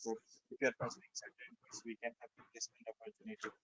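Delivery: fading away from the mic; faint, far from the microphone; barely audible. Real, not acted. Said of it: "[name], your voice is very low".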